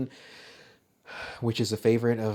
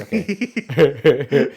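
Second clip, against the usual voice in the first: a loud put-on Eddie Murphy okay; 4 semitones higher, 10 dB louder than usual. Loud Eddie Murphy voice to the cohost. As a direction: try the Eddie Murphy bit, much louder. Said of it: "I can't do an Eddie Murphy laugh".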